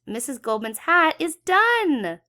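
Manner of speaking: high, loud triumphant announcement. A narrator's reading. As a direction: proclaim it's finished. Triumphant finish of hat.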